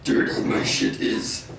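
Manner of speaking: lightly done Yoda, words turned backwards; mock little Jedi master, halting; a hint of Yoda in a backwards sentence. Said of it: "Best Yoda impression".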